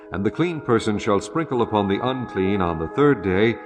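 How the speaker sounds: ordinary delivery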